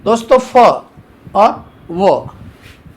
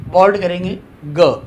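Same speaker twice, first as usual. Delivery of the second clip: normal talking voice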